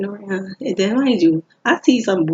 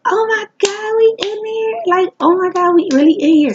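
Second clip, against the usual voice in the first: much higher, loud, thrilled squeal; ecstatic; 12 semitones higher, 6 dB louder than usual. Retold or imitated. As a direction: celebrate like you won the lottery. Celebrating getting in.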